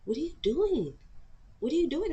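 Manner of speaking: high-pitched imitation of a puzzled friend; incredulous, voice pitched well up; puzzled questioning. Housemate who knocked on the window, puzzled questioning.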